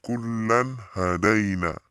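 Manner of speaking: KULLAN HADAINA slowly chanted, clear n; clear recitation. Shown as a demonstration. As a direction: model Kullan hadaina with a distinct n before h.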